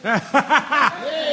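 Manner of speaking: short, high delighted laugh; pleased laugh. Acted. Juror laughing as the fan finally starts. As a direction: laugh with relief as the fan kicks on.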